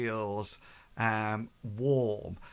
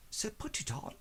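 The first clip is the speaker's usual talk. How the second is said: sly master; 9 semitones higher, 9 dB quieter than usual. Acted. Master orders player wear amulet.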